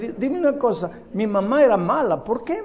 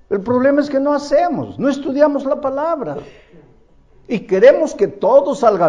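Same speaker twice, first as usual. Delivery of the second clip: loud, higher fervent preaching; preaching at full force; 6 semitones higher, 7 dB louder than usual. Acted. A pastor urging his church to study the Bible, loud fervent preaching. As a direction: drive the point home to the congregation.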